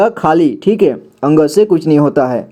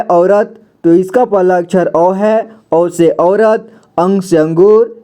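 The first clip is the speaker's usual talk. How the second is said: usual speaking voice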